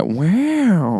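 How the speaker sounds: high, drawn-out wow in a put-on voice; Owen Wilson. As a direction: stretch the word into a soft, swooping catchphrase. Imitating Owen Wilson's catchphrase.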